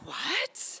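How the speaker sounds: high laughing what; mock gasp of surprise; shocked kids. Schoolfriends shocked at her answer, laughing. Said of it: "they were all like".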